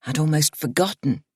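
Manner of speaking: ordinary delivery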